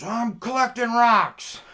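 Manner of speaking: high, loud indignant shout; yelled-back excuse. Retold or imitated. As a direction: holler back as the man caught in the bushes. Caught man yells back an excuse.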